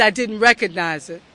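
ordinary talk

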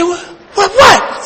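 imitating a scared, jumpy reaction; quick startled repeats, jumping high on the last; startled wife. Wife scared by husband's sudden yell, imitated startled cries. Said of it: "Scared the mess out of her".

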